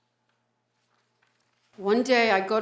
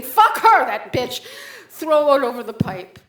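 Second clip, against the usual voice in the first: sharp high yelled curse, then loud; angry outburst; 4 semitones higher, 9 dB louder than usual. Acted. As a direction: spit it out in a burst of rage. Sudden rage at ex.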